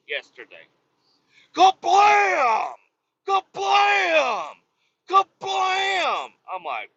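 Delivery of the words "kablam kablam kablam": high, drawn-out imitated slam; shouted door-slam sound. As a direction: yell out the crash of a slamming door. Imitates doors being slammed all day.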